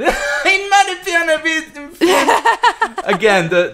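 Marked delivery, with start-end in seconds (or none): 0.0-2.3 high-pitched, slow comic imitation; high mocking echo. Echoing co-host's German line, high comic voice. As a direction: echo it high and silly through laughter.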